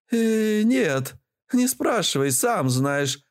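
высокий голос упрямой старухи; отнекивайся высоким бабкиным голосом; несговорчивая бабуля. A narrator's reading. Бабуля отказывается отвечать. (упрямая бабка, голос заметно выше)

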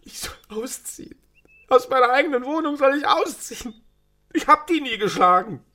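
high, whining self-pity about the flat; drunken lament. Acted. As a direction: wail that you never hit her. Drunk man lamenting his eviction. (betrunkenes, hohes Jammern)

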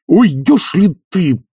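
slow, high, strained demand; exasperated, deliberate threat; through clenched teeth. A narrator's reading. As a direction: squeeze the order out impatiently. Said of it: "сжав зубы, заговорил Обломов".

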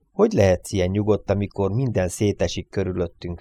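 ordinary delivery, nothing marked

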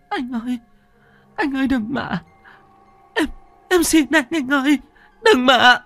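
very high, rising to frantic cries; panicked pleading. Acted. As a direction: beg in terror. Hạnh begs in terror.

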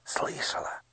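unvoiced whisper; barely moving lips. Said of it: "едва шевельнула губами Ело".

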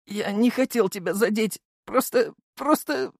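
high, whimpering apology; thin, tearful young man's voice; sobbing. A narrator's reading. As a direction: stammer it through acted sobs. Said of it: "всхлипывал Володя".

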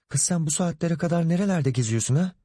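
put-on a nagging mother interrogating her girl; mother grilling her late daughter; scolding mother.

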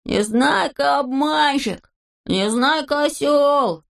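high, drawn-out jeering call; mock full-throated yell. A narrator's reading. Ponchik jeering at Neznaika, mock full-throated yell. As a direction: stretch each taunt across the square. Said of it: "и заорал во всё горло".

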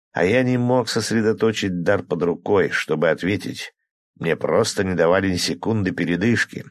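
ordinary delivery, nothing marked

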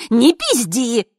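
high, loud heckle; feisty shouted retort; bold shouting. A narrator's reading. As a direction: heckle brashly from the crowd. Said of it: "бойко закричали старушки".

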